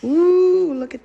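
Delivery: high loud delighted ooh; excited squeal. Real, not acted. Delighted reveal of colors.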